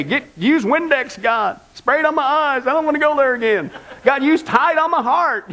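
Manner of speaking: mock prayer. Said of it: "We'd say".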